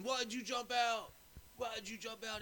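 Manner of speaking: high, loud, drawn-out demand; imitating an annoyed teammate yelling; mock yell. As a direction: shout the question at him, slowly. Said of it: "the guy's going".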